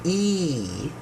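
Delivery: "E" stretched slow and steady; long E sound. Shown as a demonstration. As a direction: draw "E" out long. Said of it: "we have the long E".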